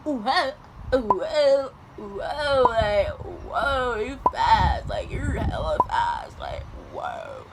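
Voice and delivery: loud, slow fending-off; flustered mock protest. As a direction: hold him off with a laugh. Fending off boy, acted.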